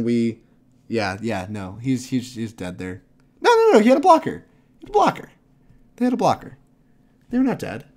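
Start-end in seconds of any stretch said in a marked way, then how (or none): 3.4-7.8 high, loud, fast protest; excited protest. Real, not acted. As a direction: shout the correction, worked up. Excited protest over board state.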